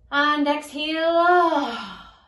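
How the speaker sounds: loud sighing ah; big release on the breath; exhale sigh. Shown as a demonstration. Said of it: "take a nice big deep breath in".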